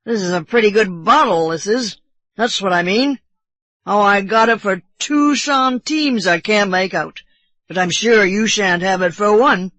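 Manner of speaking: put-on loud, boastful drunk sailor; drunken bosun. A narrator's reading. Drunk bosun refusing to sell Kiawe the bottle, loud boasting. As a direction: crow drunkenly over your prize. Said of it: "how drunken it appeared".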